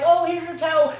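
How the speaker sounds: high, eager hand-over; happy little girl. Acted. Daughter handing the star her towel, happy little girl. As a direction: chirp it like a delighted ball girl. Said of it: "she would be so happy".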